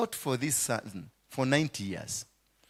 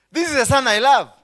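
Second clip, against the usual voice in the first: sarcastic; 9 semitones higher, 10 dB louder than usual. Acted. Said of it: "this very sarcasm of God".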